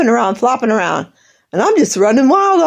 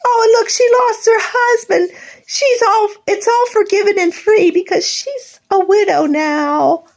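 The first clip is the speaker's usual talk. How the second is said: high tearful voice, close to sobbing; crying; 10 semitones higher than usual. Real, not acted. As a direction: speak through real tears. Tearful rant about family.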